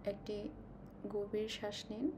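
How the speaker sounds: ordinary talk, nothing marked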